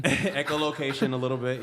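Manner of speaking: laughing through the words; amused, laughing reply. Real, not acted. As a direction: chuckle as you play along with the joke. Joking about dolphin echolocation.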